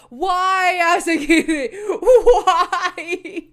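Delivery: loud wail breaking into laughter; exasperated protest. Real, not acted. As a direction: cry out to the author, half laughing. Protesting to the author.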